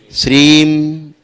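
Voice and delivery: श्रीम, drawn out slowly, ending on closed lips; teaching श्रीम, slowly. Shown as a demonstration. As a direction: stretch श्रीम, and close the mouth on m. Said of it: "ऐसे श्रीम".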